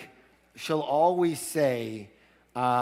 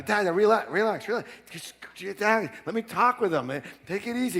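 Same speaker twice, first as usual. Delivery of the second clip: higher, pleading calm-down; calming son; 7 semitones higher than usual. Retold or imitated. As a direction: soothe an angry father. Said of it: "And I go".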